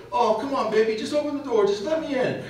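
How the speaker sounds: high, coaxing plea to his wife; sweet-talking. Retold or imitated. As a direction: soften and wheedle, not yell. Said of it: "His tone changes one hundred and eighty degrees".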